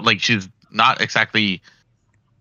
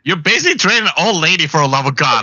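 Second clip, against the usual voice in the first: higher, louder indignant scolding; mock outrage; 8 semitones higher, 4 dB louder than usual. Real, not acted. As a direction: GM scolding the player for taking grandma's dog.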